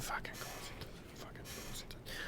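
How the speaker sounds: whispered curse under the breath. Acted. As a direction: hiss the curse to yourself.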